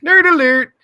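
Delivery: high, loud sing-song nerd alert; teasing playground callout; mock nerd alarm. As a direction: call it out like a siren.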